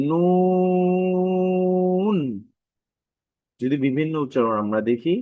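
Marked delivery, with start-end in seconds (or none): 0.1-2.2 নুন with a very long, loud, steady oo; drawn-out letter name. Shown as a demonstration. Teacher models the letter's name, long-drawn. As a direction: hold the oo of নুন high and even. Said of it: "আমরা এটাকে দীর্ঘ টান দিতে পারি".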